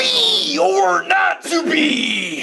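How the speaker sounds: dramatic Shakespeare. Acted. Shakespeare reading as wrestler.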